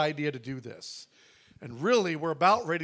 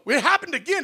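high, loud burst; excited news; 11 semitones higher, 9 dB louder than usual. Retold or imitated. Calling the contractor back, excited news. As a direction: blurt out the good news.

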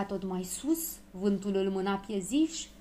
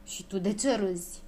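usual speaking voice

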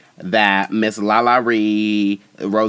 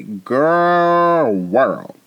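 usual speaking voice

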